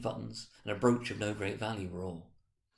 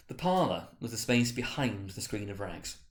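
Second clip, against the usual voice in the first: plain reading voice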